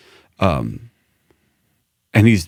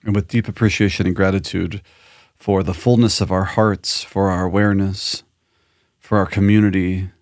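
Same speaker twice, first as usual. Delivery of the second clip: guided closing meditation. Real, not acted. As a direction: lead listeners gently through gratitude, unhurried. Leads a closing breath and gratitude.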